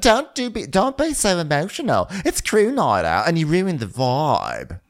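high, sassy mock scolding; bratty girlfriend. Girlfriend telling him off on crew night, high mock scold. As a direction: scold him in a shrill put-on pitch. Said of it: "She's like".

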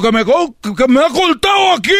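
high shouted complaint; mock pained yell; complaining customer. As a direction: cry out about the cut.

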